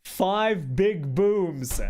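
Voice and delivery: "five big booms" slow, each word punched high and loud; emphatic count. Acted. Hammering home the total count.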